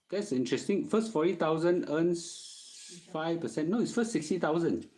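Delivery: ordinary delivery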